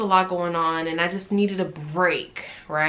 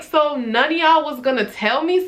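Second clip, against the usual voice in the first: high, louder hurt demand; hurt, angry outburst; 8 semitones higher, 3 dB louder than usual. Retold or imitated. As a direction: demand why nobody told you. Sadness mixed with anger.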